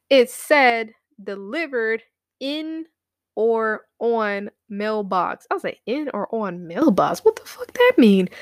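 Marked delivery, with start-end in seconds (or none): 1.2-5.5 slow, word-by-word status reading; deliberate read-out. Retold or imitated. Reading the tracking notice out, slow and deliberate. 5.9-8.3 incredulous outburst. Retold or imitated. Questioning the delivery notice, incredulous and exasperated.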